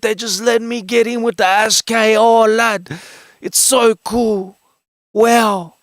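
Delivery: loud, high-pitched hyped-up impression; Spanion. Streamer shows chat how Spanion says wow, loud high impression. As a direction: shout it high in amazed disbelief, drawing out words. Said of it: "I'm gonna do it for yous right now".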